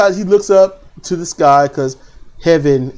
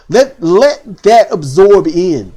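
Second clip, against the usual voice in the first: louder and drawn out; slow emphatic plea; 3 semitones higher, 6 dB louder, much slower than usual. Real, not acted. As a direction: stretch each word to make them wait. Urging crowd to wait.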